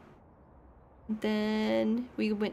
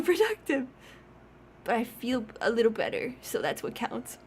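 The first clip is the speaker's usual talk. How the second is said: normal talking voice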